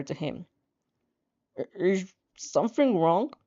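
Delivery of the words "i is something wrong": slow, mumbled through a stuffed mouth; mouth full mumble. A narrator's reading. Girl with stuffed cheeks asking Shoto, mumbling through food. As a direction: talk around a mouthful of noodles. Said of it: "You barely manage to mumble".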